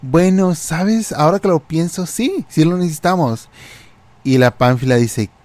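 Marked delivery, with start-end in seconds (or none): 0.0-3.5 tono agudo de complicidad fingida; siguiéndole la corriente. Acted. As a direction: finge pensarlo, con voz bien aguda. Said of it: "le agarro la onda y le digo". (haciéndose la inocente, voz aguda y juguetona)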